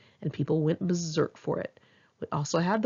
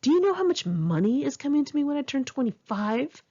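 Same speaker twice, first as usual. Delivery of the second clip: higher-pitched mock boast about money; retold blurt; 8 semitones higher than usual. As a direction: toss it out like a smug rich kid mid-argument. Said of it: "blurt during an argument one time".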